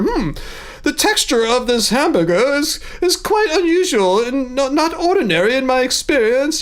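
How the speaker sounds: a child playing a snooty British food critic; posh British kid. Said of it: "that was my kid accent there. A sophisticated British person".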